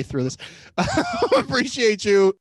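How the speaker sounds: thanks broken by real laughter; giggling through the goodbye; laughing sign-off. Real, not acted. Laughing while signing off.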